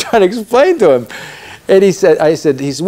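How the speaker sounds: ordinary talk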